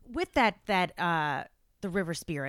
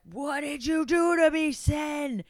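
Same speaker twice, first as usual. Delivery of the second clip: higher, louder mock wail of accusation; wailing monster complaint; 7 semitones higher, 5 dB louder, slower than usual. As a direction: cry out in mock outrage as the poisoned creature. Voicing No Face after being fed.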